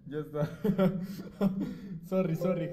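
loud burst of real laughter while apologizing; giggling apology through loud laughter; laughing. Real, not acted. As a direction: laugh out loud, then gasp out sorry.

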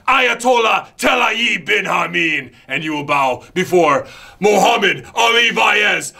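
booming villain proclaiming to infidels; megalomaniac rant. Acted. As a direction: preach your threats like a fanatic leader. Character promo rant.

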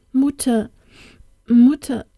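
high feverish wail for his mother; a sick boy crying out for his mother. A narrator's reading. Feverish boy calling for his mother, wailing. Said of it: "klang es jammernd durch den Raum".

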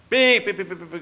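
high, loud chirping pi pi pi that fades off; partridge cry imitation. Retelling a horse ride, mimics the partridge cry. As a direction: squeal it like a startled partridge bursting from the grass.

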